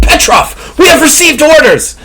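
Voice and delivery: high, urgent insistence on orders; strained, agitated soldier; heated insistence. Acted. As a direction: press your partner hard, pitch way up. Gunman insists on orders in quarrel.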